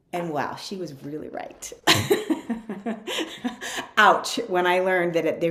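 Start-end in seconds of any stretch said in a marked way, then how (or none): 1.8-3.9 laugh. Real, not acted. Laughing at her own story.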